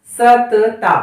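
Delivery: सतता with two full त sounds, no half letter; wrong full ta. Shown as a demonstration. Teacher showing students the mistaken सतता. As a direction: say सतता as स-त-ता, each त complete. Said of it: "अब सतता बोलकर देखिए".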